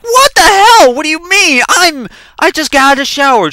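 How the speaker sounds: very high, loud hammed-up yell; acted-out outraged comeback; indignant yelling. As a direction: yell back in shrieked protest. Acted quarrel with sister.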